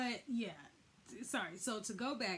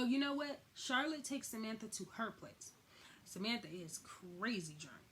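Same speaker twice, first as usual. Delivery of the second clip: usual speaking voice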